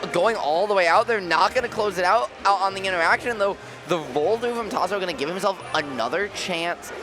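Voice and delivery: energetic esports play-by-play call; live play-by-play. Acted. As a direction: call the action as it happens. S0 calls the match live.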